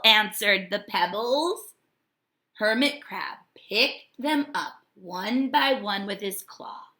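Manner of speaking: plain reading voice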